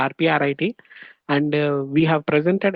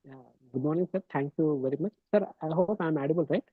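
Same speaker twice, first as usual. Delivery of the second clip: very faint, low-volume voice; speaking much too softly to hear; barely audible; 2 semitones lower, 5 dB quieter than usual. Real, not acted. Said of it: "sir, your voice is very low".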